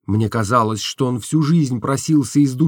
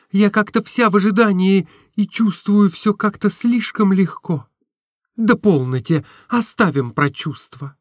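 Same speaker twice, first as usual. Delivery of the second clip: put-on higher girlish voice, eager; Nastenka's voice; 8 semitones higher than usual. A narrator's reading. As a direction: gush to your friend, a little naive. (высокий голос Настеньки, восторженно)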